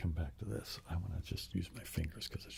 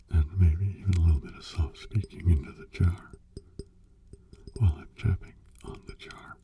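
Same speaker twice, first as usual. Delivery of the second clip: hollow whisper inside a jar; 12 dB louder than usual. Acted. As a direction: whisper right into the glass jar.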